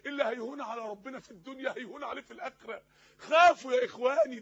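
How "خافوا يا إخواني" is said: loud, forceful exhortation; urgent warning. Real, not acted. Preacher warning his brothers of the Hereafter, urgent and loud. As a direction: cry the warning out to the crowd. Preacher urges congregation to fear.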